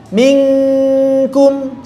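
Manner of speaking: minkum with a thin, light kaf, nun hidden; teacher's model minkum. Shown as a demonstration.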